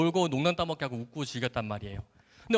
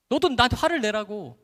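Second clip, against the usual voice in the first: higher, louder goading demand; retold anger; 6 semitones higher, 5 dB louder than usual. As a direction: provoke them, reenacting a quarrel. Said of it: "그렇게 막 화를 내고 있는데".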